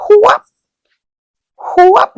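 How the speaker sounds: high, loud whoop for each lash; belt whoop sounds. Retold or imitated. Imitating dad's belt strikes in the story, high loud whoops. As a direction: mimic the belt cracking down.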